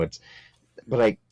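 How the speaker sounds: normal talking voice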